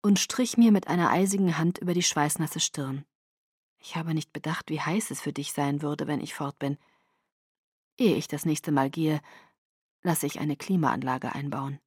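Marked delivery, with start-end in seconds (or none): 3.8-11.8 soft, low murmur; Edward. Acted. Boyfriend apologizing to his overheated girlfriend, murmuring. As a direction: keep it hushed and low, apologetic. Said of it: "murmelte Edward".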